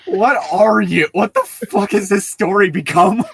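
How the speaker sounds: half-laughing exasperation; incredulous outburst. Real, not acted. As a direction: throw up your hands at the story. Exasperated reaction to the story.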